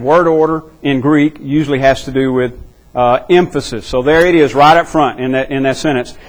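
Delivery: usual speaking voice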